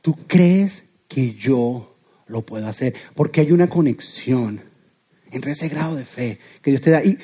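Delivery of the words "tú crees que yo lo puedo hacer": God asking gently. Retold or imitated. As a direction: ask it slowly and tenderly, really wanting an answer. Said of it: "Te hago una pregunta".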